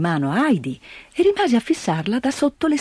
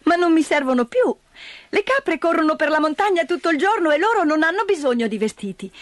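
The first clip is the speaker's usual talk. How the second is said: put-on high, bright child voice; cheerful little girl; 7 semitones higher than usual. Heidi explains to grandfather, high child voice. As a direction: reason it out like an innocent child.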